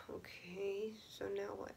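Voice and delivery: hushed, near-whispered reply; deflated reaction. Retold or imitated. Left stunned after mom runs out.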